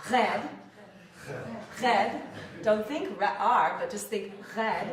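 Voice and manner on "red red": "red" with a French R and air at the back, loud. Shown as a demonstration. Coach drills the French R for students, loud. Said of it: "make a little air back there".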